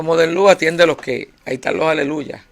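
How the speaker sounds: llamando alto a una compañera; orden en voz alta. Retold or imitated. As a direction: manda fuerte, con prisa. (orden en voz alta)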